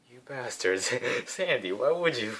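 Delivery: shouting at the hurricane in fun; mock outrage.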